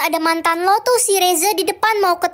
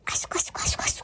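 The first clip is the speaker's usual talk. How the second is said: hushed, voiceless whispering; 13 dB quieter, faster than usual. Acted. Friend slipping Ren the quiz answer, whispered. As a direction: whisper nonsense syllables as a secret hint. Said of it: "Sini gue bisikin".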